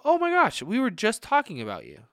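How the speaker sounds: high, loud "oh my gosh"; surprised greeting. Retold or imitated. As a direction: burst out as if caught mid-gossip. Acquaintance caught talking about you.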